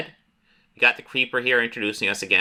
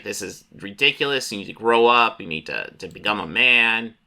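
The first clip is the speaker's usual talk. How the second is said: normal talking voice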